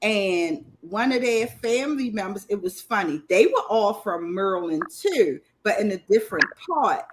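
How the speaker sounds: usual speaking voice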